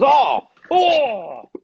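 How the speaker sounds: high, loud mock yelp of shock; livid outcry. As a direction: imitate an outraged, high-pitched cry. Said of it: "he's, he's livid".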